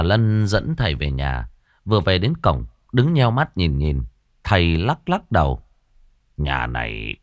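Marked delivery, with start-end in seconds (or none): 6.4-7.2 lower, slow ominous pronouncement; a hint of a grim old sorcerer. A narrator's reading. Sorcerer judging the widow's house, slow lower ominous voice. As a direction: warn of the cursed house, gravely.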